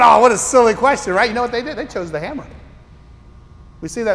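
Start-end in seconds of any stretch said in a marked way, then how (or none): 0.0-1.1 scoffing at a silly question; mock dismissive. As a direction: brush it off like it's obvious. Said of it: "you guys are going".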